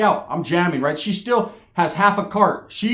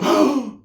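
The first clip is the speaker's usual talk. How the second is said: offended gasp; 7 semitones higher than usual. Retold or imitated. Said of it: "And she goes".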